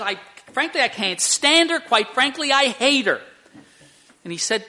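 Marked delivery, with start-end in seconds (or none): 0.0-3.2 high, loud retold outburst; livid, acted out. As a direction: act out a husband boiling over. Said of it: "he said he was livid".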